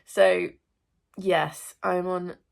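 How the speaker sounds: ordinary talk, nothing marked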